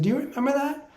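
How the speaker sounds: high playful question; humorous spirit voice. Retold or imitated. Spirit guides quizzing him mid-dance, high playful. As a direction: ask it like a grinning spirit. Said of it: "the way they speak is humorous".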